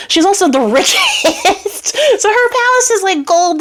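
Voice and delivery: can't keep a straight face telling it; laughing. Real, not acted. Narrator laughing at the rich princess mid-story.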